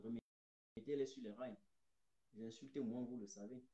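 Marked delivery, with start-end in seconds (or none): none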